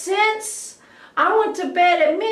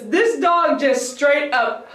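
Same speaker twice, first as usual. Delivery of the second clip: normal talking voice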